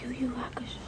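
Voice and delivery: hushed whisper in the library; quiet whispered tour of the shelves. Real, not acted. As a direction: keep it down, whisper so nobody hears.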